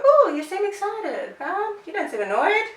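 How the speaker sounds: high, soft, amused dog voice; lightly voiced smug dog; dog's voice. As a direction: voice the dog reading you. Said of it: "make the dog go".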